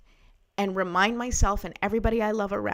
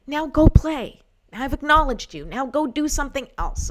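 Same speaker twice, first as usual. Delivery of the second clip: higher-pitched, firm send-off; firm dismissal; 6 semitones higher, 4 dB louder than usual. Acted. Speaker sending the acknowledged goblin away, firm dismissal. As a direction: shoo it away like a child sent out to play.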